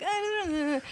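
high wordless dithering noise; squirming reluctance. Retold or imitated. Woman asked for a kiss, squirming reluctance.

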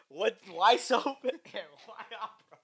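laughing through the question. Real, not acted. Mock deep question, laughing.

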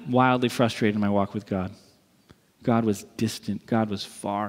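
low, subdued admission; deflated confession. Real, not acted. Confesses disappointed walk with God.

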